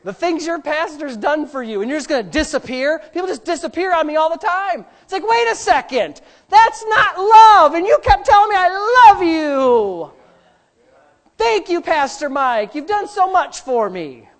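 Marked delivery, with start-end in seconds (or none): 5.2-14.3 mock outcry. Hurt pastor's pretend outcry.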